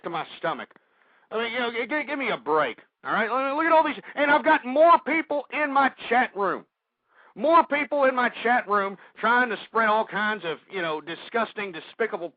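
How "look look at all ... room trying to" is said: exasperated. Real, not acted. Exasperated outburst about chat.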